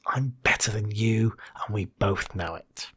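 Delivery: a soft, whispered sneer of superiority; contemptuous tone. Shown as a demonstration. Coach demonstrating a partner's contemptuous tone, whispered sneer. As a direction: let a quiet smugness drip off it. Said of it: "It's that tone that says".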